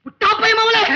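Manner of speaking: high, agitated rebuke; angry cursing. Acted. Father curses daughter for ruining job. (கோபத்தில் திட்டும் குரல்)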